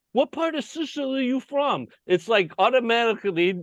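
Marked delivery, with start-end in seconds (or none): 0.1-1.9 high-pitched imitated question; curious locals. Sicilian locals asking a visitor his origins, imitated eagerly. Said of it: "they would say".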